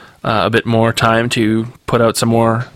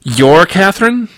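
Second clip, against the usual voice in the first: slow, higher, amazed question; astonished; 8 semitones higher, slower than usual. A narrator's reading. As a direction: stretch it out in rising disbelief. Astonished at hearing her name.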